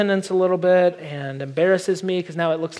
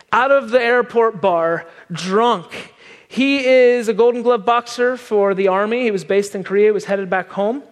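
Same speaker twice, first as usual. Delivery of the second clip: normal talking voice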